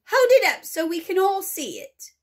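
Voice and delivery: excited crowd shout. Acted. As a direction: yell it from the back of the crowd. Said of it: "shouted somebody".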